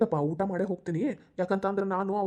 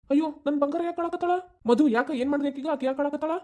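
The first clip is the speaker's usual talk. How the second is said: put-on high-pitched fussing over a child; doting concern; 7 semitones higher than usual. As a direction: coo with alarm at your crying darling. Father fussing over crying child.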